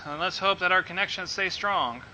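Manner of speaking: ordinary delivery, nothing marked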